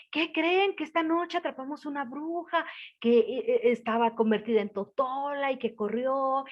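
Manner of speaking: high, loud rush of news; excited news. Acted. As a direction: burst in breathless to tell everyone. Father bursts in with news. (noticia gritada con emoción, aguda y fuerte)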